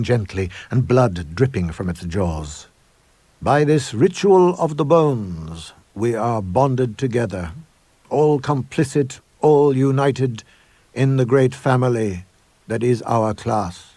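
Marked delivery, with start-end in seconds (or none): 3.4-13.9 higher, slow, solemn headmaster's proclamation; grand declaration. Acted. Headmaster proclaiming the ritual to the assembled boys, solemn and grand. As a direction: declare it like a high priest at a ritual. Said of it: "he declared".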